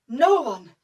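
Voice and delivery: plain reading voice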